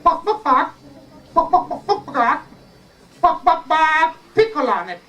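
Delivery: high, clucking chicken voice; magic chicken. Acted. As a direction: cluck the words like a hen. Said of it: "But the magic chicken said".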